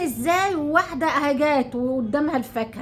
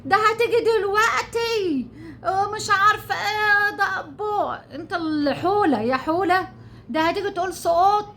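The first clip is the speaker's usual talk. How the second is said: mocking fake crying; 5 semitones higher than usual. Mocking a rival YouTuber's staged tears, whiny high crying. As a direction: mimic her tearful act, pitched way up and dragged out. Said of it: "وتعمل لك ازاي؟".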